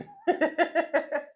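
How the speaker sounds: loud, high burst of laughter. Real, not acted. Teacher amused by the eba example, laughing high.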